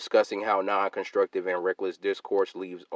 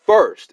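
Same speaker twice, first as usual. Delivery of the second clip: "first" much higher and louder, punched; all-caps emphasis; 11 semitones higher, 12 dB louder than usual. Shown as a demonstration. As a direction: spike the voice on "first". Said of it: "and I capitalized the word first".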